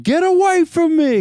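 high, loud shout to drive him off; pretend scream. Husband yelling at helpers to back off. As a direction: yell it like you've had enough. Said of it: "See, I'm screaming".

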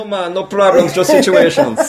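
put-on amateur Jamaican accent, breaking into laughter. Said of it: "help me out, man".

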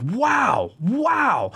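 high, swooping, drawn-out wows; exasperated wonder. Retold or imitated. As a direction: relive saying it over and over in awe.

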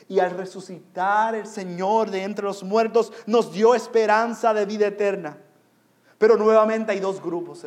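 loud sermon voice, pushed out firmly; emphatic pulpit delivery; forceful preaching. Real, not acted. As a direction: proclaim the hope to the congregation. Preacher still proclaiming.

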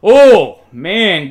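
big, loud sportscaster "oh"; amazed exclamation. Pretend. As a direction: burst out at a crunching hit. Reacting to a hard tackle.

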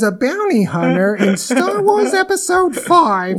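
high, slow, strained nerd voice; whiny nasal-sounding comic impression; Gilbert Gottfried voice. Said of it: "that Gottfried impression ... that's my nerd voice".

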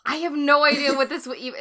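normal talking voice

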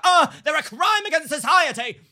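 high, loud, hammed-up gasp of horror; mock outrage. Imagined onlooker judging her, hammed-up outrage.

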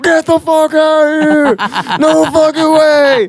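high, drawn-out scream of disbelief, re-enacted; retold squeal of stoked amazement; excited phone scream. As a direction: act out screaming down the phone, stretched out. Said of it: "screaming on the phone".

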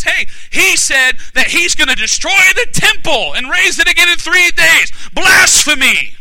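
high, loud acted accuser; shouted accusation. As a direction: cry it out as an outraged charge. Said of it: "One of the accusations that was brought against him".

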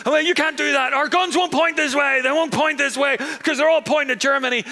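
hammed-up alarm as the enemy walks round; panicked French shout. French soldiers realising Germany came through Belgium, high panicked shouting.

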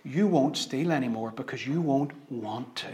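the Spirit's quiet promise; gentle promise. Retold or imitated. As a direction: say it softly as a promise.

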